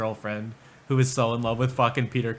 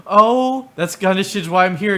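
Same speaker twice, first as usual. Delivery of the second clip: high, loud gasped "oh"; mock eager sympathy; 6 semitones higher, 6 dB louder than usual. Someone waiting to jump on this guy, mock eagerness. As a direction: play an over-keen fellow victim jumping in. Acts gasping fellow sympathiser.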